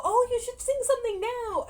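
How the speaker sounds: high, loud imitation of keen relatives; mock-excited pushy suggestion; eager urging. Family urging her to sing.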